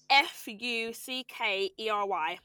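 each letter spelled out slowly. Shown as a demonstration. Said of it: "spelling out".

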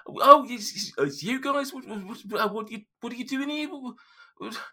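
high Geordie gnome, fast and flustered; startled gnome. Acted. GM voicing Geordie spotting the party, high flustered gabble. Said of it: "He's like".

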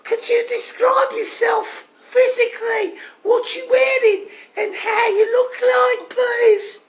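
very high, comic Brummie caller; Dave from Birmingham. Acted. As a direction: pitch it way up, cheeky Birmingham accent. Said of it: "it's me accent".

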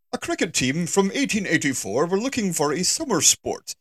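lightly voiced old-timey narrator. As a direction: tell it like an old newsreel. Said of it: "That was my old-timey voice".

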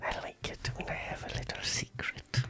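soft secret whisper. Acted. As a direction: breathe it like a secret.

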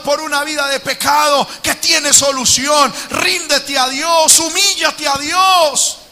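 fiery preacher's call to surrender; full-force preaching. Acted. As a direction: urge the congregation toward God.

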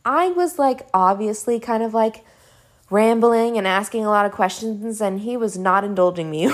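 usual speaking voice

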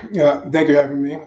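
hoarse voice from being ill. Real, not acted. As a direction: speak with a hoarse, worn voice. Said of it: "As you can hear, I'm a little bit hoarse today".

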